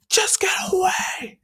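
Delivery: high, loud, strained urgent cry; high strained warning. Retold or imitated. All Might warns Izuku to stay away, straining. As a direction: push them back, urgent. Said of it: "All Might's like".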